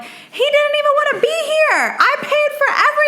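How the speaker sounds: very high, indignant rant; going off. Retold or imitated. Sister went off about brother, high-pitched retold outburst. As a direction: reenact an aggrieved blow-up. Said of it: "I went off and I'm like".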